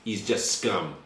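put-on lightly accented Russian revolutionary, scornful; Russian-accented prisoner. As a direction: sneer at the king, Russian accent. Prisoner mocks the king.

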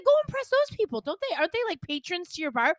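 high-pitched, sassy send-off; mock dismissal. Sending friend off to bar patrons, mock-dismissive.

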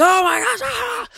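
very high, loud panicked shriek; mock panic. Imitating a first responder arriving in a panic, shrieking. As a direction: scream it in hammed-up alarm.

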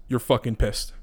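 usual speaking voice

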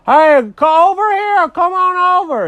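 loud, high holler across a yard; high, shouted invitation; calling out. Acted. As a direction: call a neighbor over eagerly. Calling the neighbor over.

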